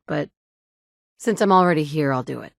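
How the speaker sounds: usual speaking voice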